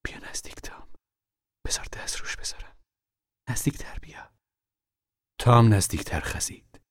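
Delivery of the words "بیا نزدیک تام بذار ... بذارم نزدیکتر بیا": urgent whisper in the dark; hushed whisper. Acted. Mother whispering warnings to her hiding son. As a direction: keep it breathy and secret.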